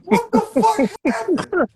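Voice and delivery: high, half-laughing bewilderment; retold disbelief with a laugh. Man retelling seeing a handprint, laughing disbelief. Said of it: "I said".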